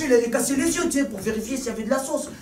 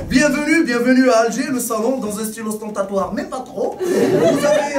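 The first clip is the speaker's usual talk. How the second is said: Algerian tour guide; 3 semitones higher than usual. Acted. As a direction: sell the villa like an overeager guide, laughing through it. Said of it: "un guide touristique en Algérie qui fait".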